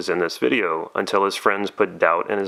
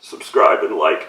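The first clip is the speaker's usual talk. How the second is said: louder, lower, forced out; mock sob-shout; 3 semitones lower, 5 dB louder than usual. He blurts a plea to viewers through mock tears. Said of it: "Makes me emotional".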